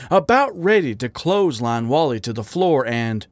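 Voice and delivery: ordinary delivery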